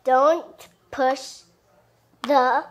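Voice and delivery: usual speaking voice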